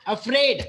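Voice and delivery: "afraid" with a tiny a and a loud -fraid; stress on second syllable. Shown as a demonstration. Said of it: "emphasizing more on the afraid". ("afraid" में -fraid पर ज़ोर)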